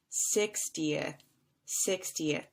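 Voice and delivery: "sixtieth" as six-ti-eth, falling, short ending; three-syllable sixtieth. Shown as a demonstration. Teacher contrasting with sixteenth, "sixtieth" in three falling syllables. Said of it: "three syllables".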